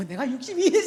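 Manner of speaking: words breaking up in laughter; amused at own mistake; laughing at oneself. Real, not acted. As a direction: laugh through it, pitch jumping.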